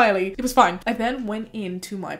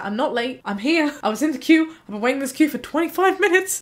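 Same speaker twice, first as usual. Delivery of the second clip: rising, louder, insisting she was there; indignant protest; 5 semitones higher, 4 dB louder than usual. Retold or imitated. Protesting to the receptionist that she isn't late, rising and louder. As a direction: protest the accusation, voice climbing.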